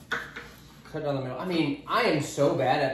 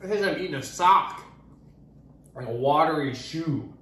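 ordinary talk, nothing marked

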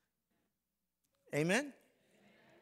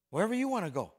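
mildly higher, louder mock husband; the man's reply; 5 semitones higher, 8 dB louder, much faster than usual. Preacher voices the husband giving in, a mild mock. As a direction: give in like a clueless husband. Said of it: "And the man".

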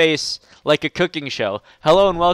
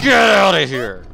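mock shout; 3 semitones higher, 6 dB louder than usual. Cat shoving things off shelf.

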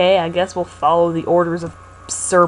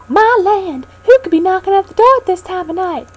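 put-on old lady voice; 11 semitones higher, 4 dB louder than usual.

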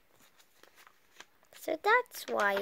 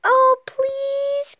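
high, loud, drawn-out plea; pleading cry, pitched right up; 11 semitones higher, 12 dB louder than usual. A narrator's reading. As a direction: beg loudly like an eager child. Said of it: "cried Froggy".

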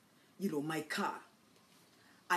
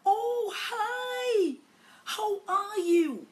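very high, loud, drawn-out hello; exaggerated high-pitched friendly hail; effusive greeting; 12 semitones higher, 7 dB louder, much slower than usual. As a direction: sing out a gushing greeting.

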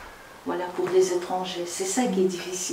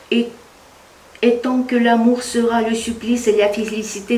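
reading a quoted text with raised voice and lift; poetic recitation; 3 semitones higher, 4 dB louder than usual. Acted. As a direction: recite the passage with fervour. Reads Banville's text aloud. (lecture déclamée d'un texte)

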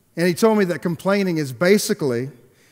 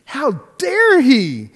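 "How dare he" flung out high, loud and slow; hammed-up fury at "dare"; indignant outburst; 10 semitones higher, 9 dB louder, much slower than usual. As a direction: let the outrage burst through, dragging each word. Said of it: "I got so mad".

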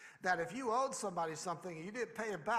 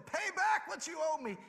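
high, loud, shouted order; hammed-up demand; 10 semitones higher, 6 dB louder than usual. Servant demands payment while choking him, high loud shout. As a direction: bark it at the man on his knees. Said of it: "he demanded".

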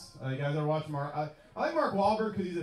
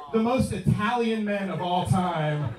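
normal talking voice

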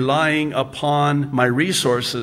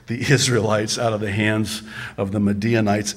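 ordinary talk

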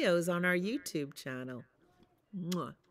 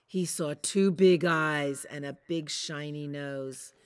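usual speaking voice

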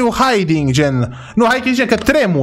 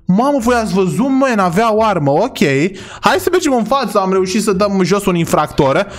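usual speaking voice

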